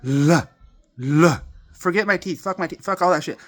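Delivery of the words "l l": long ringing "l" said loud and held; sounding the letter l. Shown as a demonstration. Demonstrating the l sound to the camera, humming and resonant. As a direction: let the "l" hum and resonate. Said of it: "You hear that?".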